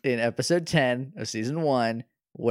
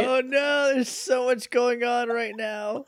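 dismayed; 10 semitones higher, slower than usual. Real, not acted. Reacting to a sad scene.

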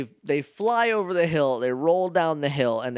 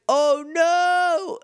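much higher, louder gasp of alarm; shocked Oh no; 3 dB louder than usual. Retold or imitated. Justin seeing Carlos's face on the ground, high mock alarm. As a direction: squeal it in mock horror. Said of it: "and he's like".

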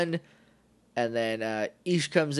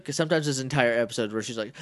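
normal talking voice

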